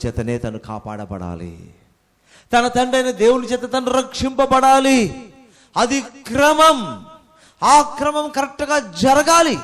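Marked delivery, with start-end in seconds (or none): 2.5-9.6 loud, higher, slowing on the key words; preaching at full force. Acted. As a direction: proclaim it to the congregation. Preacher proclaiming divine order. (సంఘానికి గట్టిగా ప్రకటిస్తూ, బోధించే స్వరంలో)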